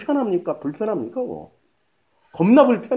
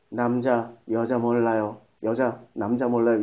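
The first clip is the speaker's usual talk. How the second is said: low, toneless robot monotone; emotieloze AI; 11 semitones lower, 6 dB quieter than usual. Acted. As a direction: strip every feeling out, like an AI speaking. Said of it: "이런 목소리 있잖아. 감정 다 빼".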